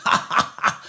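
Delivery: soft, voiceless mock chuckle; quiet staccato "ha ha ha" laugh; imitated private laughter. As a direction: imitate someone laughing to himself. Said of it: "he's, like, laughing by himself".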